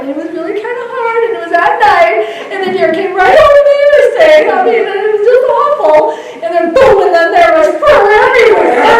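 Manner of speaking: high, loud, fast and breathless; excited retelling. Real, not acted. Nurse leader reliving the deer crash, high and excited. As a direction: tumble through the crash story, high and loud.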